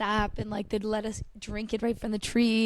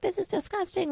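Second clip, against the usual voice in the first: disgusted whine; 9 semitones higher than usual. Retold or imitated. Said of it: "I was like".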